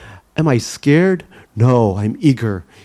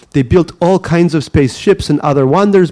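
put-on higher Russian accent, slowed down; slower Russian-accented reading; 5 semitones higher, 3 dB louder than usual. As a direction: read carefully, thick Russian accent. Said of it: "So I continue a lot slower".

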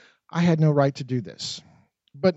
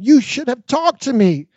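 higher and louder, rising alarm; upset wife protesting; 9 semitones higher, 5 dB louder than usual. A narrator's reading. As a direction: plead in mounting distress.

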